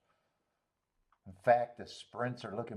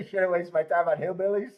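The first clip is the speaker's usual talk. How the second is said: laughing; 6 semitones higher, 7 dB louder than usual. Real, not acted. Laughing remark.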